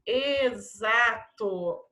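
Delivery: "Exato" very slow, in long syllables with a z. Shown as a demonstration. Teacher confirming Z to pupils, "Exato" slowly sounded out. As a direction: dictate "Exato" as e-za-to. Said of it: "Som de Z".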